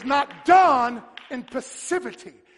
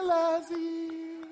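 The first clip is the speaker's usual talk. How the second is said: slow singsong, like calling a pet; powerless coaxing; 5 semitones higher, 6 dB quieter, slower than usual. Acted. Preacher showing a call without power to Lazarus, coaxing. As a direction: draw it out in a weak, wheedling croon. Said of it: "That ain't power".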